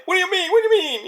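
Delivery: high, baffled whine; high-pitched indignant protest; whiny Fox. Retold or imitated. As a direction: plead in a high voice. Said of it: "it's like".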